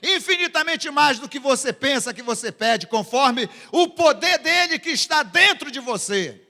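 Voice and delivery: high, loud declaration; full-voiced preacher's climax; rousing preaching. Real, not acted. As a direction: surge up and proclaim it. Preacher's rousing climax after question.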